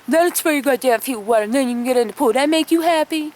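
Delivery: a dad grudgingly giving in; gruff dad. Retold or imitated. Dad telling Kim to wash the boy's feet, gruff and sarcastic. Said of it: "He's like".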